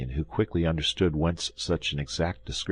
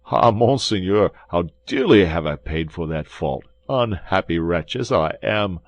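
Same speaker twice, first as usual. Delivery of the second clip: louder lament of a wretch; pitiable pleading; 2 semitones higher, 7 dB louder than usual. Acted. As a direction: wail over your own misfortune. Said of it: "in the most pitiable voice imaginable".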